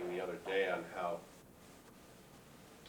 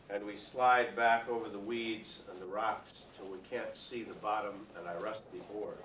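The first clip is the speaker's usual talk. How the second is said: slow, measured poem recital, lines lifted then falling away; louder opening on each phrase, settling at its end; poetry reading; 3 semitones higher, 7 dB louder than usual. Acted. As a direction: read your poem to a hushed audience, projected and unhurried. Poet reads his poem aloud.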